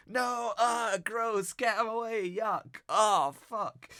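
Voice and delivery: high, loud squeals of revulsion; mock disgusted cries. Women fleeing the milk-guzzling stripper, mock disgusted cries. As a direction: recoil with exaggerated high-pitched yucks.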